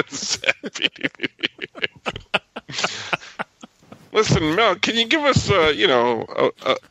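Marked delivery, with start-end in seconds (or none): 0.1-1.9 sighing, barely voiced and slow; breathy and mournful; sad. Shown as a demonstration. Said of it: "Give us a sad Beady".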